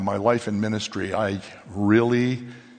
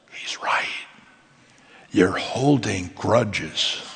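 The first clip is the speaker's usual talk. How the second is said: whispered then slow and low; a hushed, slow inner voice of God; whispered rebuke from the Spirit; 4 semitones lower, much slower than usual. Retold or imitated.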